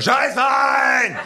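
drawn-out top-volume high shout. Acted. Narrator urging official to try, screaming. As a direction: yell it with everything, stretched long. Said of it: "rufe noch lauter".

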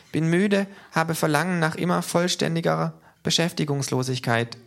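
very high, squeaky-pitched character voice, insistent; K, small creature voice. A narrator's reading. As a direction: play K high above your own voice. (sehr hohe Figurenstimme, eifrig)

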